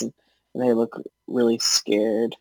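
ordinary talk, nothing marked

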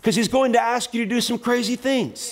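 high-pitched, full-force exhortation; fervent preaching. Real, not acted. Preacher urging church to obey God, impassioned preaching.

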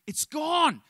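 high, loud happy yell of it's gone; retold joyful yell. Preacher acts the janitor yelling in the bathroom, high and loud. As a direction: shout it out like a man freed. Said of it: "he starts yelling".

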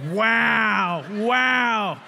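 big shouted wow into the mic; loud wow. Real, not acted. Youth speaker to crowd, shouting wow into microphone. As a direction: belt it out to the crowd. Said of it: "So much power with the microphone".

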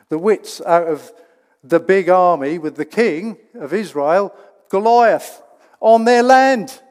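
loud, driving proclamation; full-force preaching. Acted. Preacher rallying the church against the devil, loud and driving. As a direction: push it out to the whole church.

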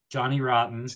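normal talking voice

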